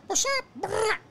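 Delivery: high-pitched taunt with a trilled gun burst; playful high mock gunfire; mock gunfight taunt. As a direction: pretend to greet an intruder, then spray bullets. Mimics greeting a home intruder with gunfire.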